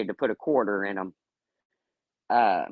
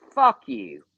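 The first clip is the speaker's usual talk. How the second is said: high, loud retort at a guest; retold fuck you; 10 semitones higher, 4 dB louder than usual. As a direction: fire it back at him, high and loud. Retold snapping back at a guest.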